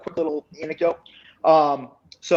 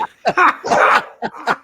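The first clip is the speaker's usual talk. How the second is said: high loud laugh; 13 semitones higher, 7 dB louder than usual. Real, not acted. Telling friends the prank story, bursting into high laughter.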